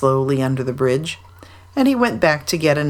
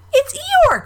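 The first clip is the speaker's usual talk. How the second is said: very high squealed discovery; terribly excited; 3 dB louder than usual. A narrator's reading. Roo spots Eeyore in the river, excited squeal. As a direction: squeal it like a thrilled small child. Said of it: "terribly excited".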